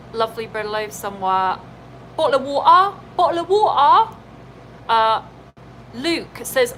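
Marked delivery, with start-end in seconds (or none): 2.2-3.0 glottal cockney "bottle of water"; cockney accent. Pretend. Mock cockney phrase. 3.2-4.1 higher, louder cockney "bottle of water"; cockney accent. Pretend. Repeated higher.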